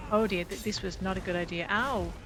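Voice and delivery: ordinary delivery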